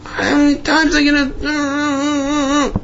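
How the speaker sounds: very high, drawn-out mock screech; acted-out exasperated tirade; upset outburst. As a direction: lose patience in a strained squeal. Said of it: "get all upset".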